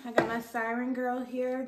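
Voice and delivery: ordinary talk, nothing marked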